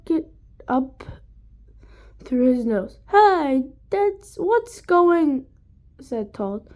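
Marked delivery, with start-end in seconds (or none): 3.1-5.5 put-on child calling out. A narrator's reading.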